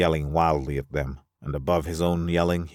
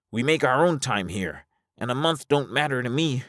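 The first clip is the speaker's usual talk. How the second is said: high, animated character voice; high-voiced Alvar, insistent; 10 semitones higher than usual. Alvar wanting to turn back, high insistent voice. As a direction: argue stubbornly in a high register.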